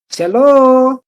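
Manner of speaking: yelled, high drawn-out greeting; Mancho Cheris. Acted. Innkeeper calling from the tavern doorway, yelled. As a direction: call out loud and high, stretching the last vowel. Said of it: "voice of Mancho Cheris yelling out".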